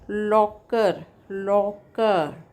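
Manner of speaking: Locker stretched slowly into two drawn-out beats; syllable by syllable. Shown as a demonstration. Teacher showing students the mouth openings, syllable by syllable. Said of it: "count how many times your mouth opens".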